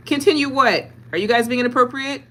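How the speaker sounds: teasing scold, loud; playful fake outrage; mock stern.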